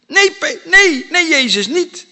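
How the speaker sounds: high, loud, urgent refusal; vehement protest. Acted. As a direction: blurt it out as an alarmed objection. Acts out Peter's protest.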